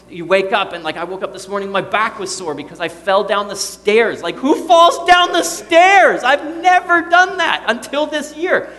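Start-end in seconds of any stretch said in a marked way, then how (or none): 4.4-8.7 very high, loud comic outrage; exasperated disbelief. Acted. Preacher telling congregation of falling down the stairs, exasperated. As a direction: cry out in incredulous exasperation at yourself. Mock outrage at own clumsiness.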